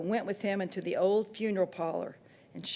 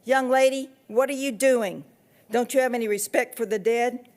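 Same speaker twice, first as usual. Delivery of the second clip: loud, higher scolding yell; yelling back; 4 semitones higher, 5 dB louder than usual. A narrator's reading. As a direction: shout her down for her disrespect. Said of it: "yelled back at me".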